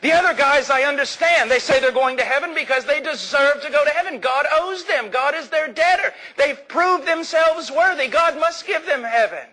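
high-pitched, quick challenge; baffled reporter. Acted. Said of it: "the reporter says".

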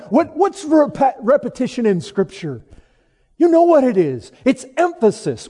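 high, loud sermon delivery; emphatic preaching. Real, not acted. Preacher asks why Scripture repeats itself, emphatic preaching. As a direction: drive the point home to the congregation.

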